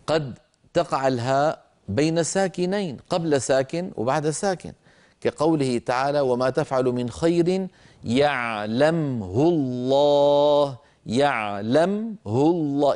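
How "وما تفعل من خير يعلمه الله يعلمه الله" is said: slow chanted recitation; Quran recitation. Shown as a demonstration. Teacher reciting an example verse for students.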